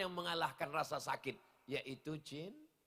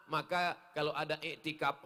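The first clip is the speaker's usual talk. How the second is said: ordinary delivery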